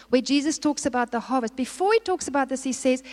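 normal talking voice

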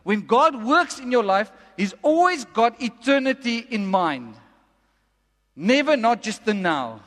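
slow, emphatic preaching delivery; deliberate, weighty pace with punched key words. Real, not acted. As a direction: spell it out slowly for the congregation. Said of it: "I'm gonna say it very slowly".

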